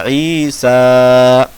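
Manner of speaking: ईसा chanted with both vowels held long; very slow ईसा on a level tone; elongated recitation. Shown as a demonstration. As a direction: stretch सा of ईसा, loud and steady. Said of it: "कैसे पढ़ेंगे?".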